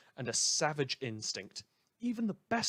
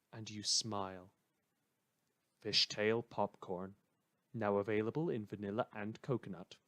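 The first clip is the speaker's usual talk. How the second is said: intimate ad read; 4 semitones lower than usual. Acted. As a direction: lull the listener into a cozy memory. Popcorn ad read.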